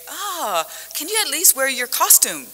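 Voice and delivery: lightly voiced pushy colleague; a hint of a clueless colleague; imitating colleague. Colleague quoted pushing costume request.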